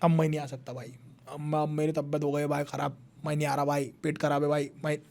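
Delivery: low, quiet sick-man excuse; feigning illness. Acted. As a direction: play poorly, voice sunk low and soft. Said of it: "Shivaji Maharaj ने तब कहा कि, मेरी तबीयत खराब". (बीमारी का बहाना, धीमी दबी आवाज़ में)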